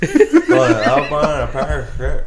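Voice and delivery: tongue held between fingers, words garbled; holding tongue with fingers. Shown as a demonstration. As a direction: say it while pinching your tongue. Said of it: "Yeah, just like that".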